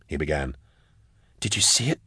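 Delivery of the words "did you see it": hushed, higher, softer voice; whispered question. A narrator's reading. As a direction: ask it under your breath, pitch lifted. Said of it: "she whispered".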